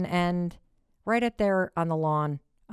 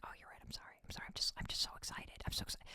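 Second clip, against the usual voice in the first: whispered apology, fast at the end; sheepish whisper; 18 dB quieter, much faster than usual. Retold or imitated. Mother whispering sorry to her husband, sheepish. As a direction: whisper it like a caught kid. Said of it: "And I'm like".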